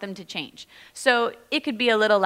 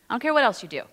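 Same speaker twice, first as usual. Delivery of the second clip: normal talking voice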